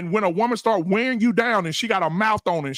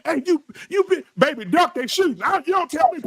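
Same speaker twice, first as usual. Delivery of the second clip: acted urgent warning; 8 semitones higher than usual.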